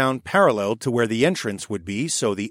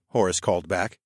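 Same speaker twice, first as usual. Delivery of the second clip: plain reading voice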